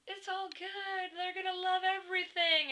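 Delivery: high, slow, drawn-out singsong; imitated squeaky reassurance; Kayla, upbeat. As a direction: stretch it out high like a gushing friend. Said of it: "Kayla's like".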